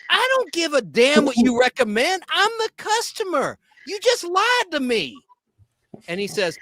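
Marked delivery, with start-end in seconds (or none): 0.1-5.2 much higher and louder, slowing on the last words; indignant customer. Retold or imitated. Woman demanding rolling papers from the clerk, high indignant voice. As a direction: play the outraged lady, pitched way up.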